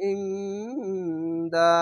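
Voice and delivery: long hummed ng in ইং, then দা; ikhfa ghunnah. Shown as a demonstration. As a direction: linger on the nasal in ইং before landing on দা. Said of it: "ইকফা গুন্না".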